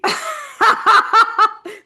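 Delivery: loud high laugh; burst of laughter. Real, not acted. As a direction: break into delighted laughter. Laughing at the chosen name.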